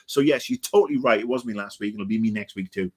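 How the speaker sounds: ordinary talk, nothing marked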